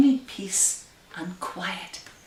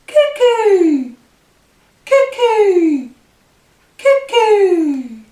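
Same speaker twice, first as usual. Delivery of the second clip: very high, loud cuckoo shout; cuckoo call; 12 semitones higher, 14 dB louder, much slower than usual. Acted. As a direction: call out like the clock's bird popping out. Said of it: "our tick-tock song".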